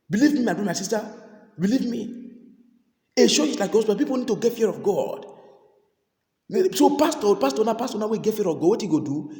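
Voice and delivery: urgent call to repent; fervent preaching. Real, not acted. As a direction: press the congregation hard. Preaching at full force.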